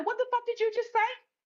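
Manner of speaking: sudden high-pitched furious snap; mock rage, pitch jumps way up; high yell. Snapping at the bartender, high furious yell. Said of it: "that's when I started yelling".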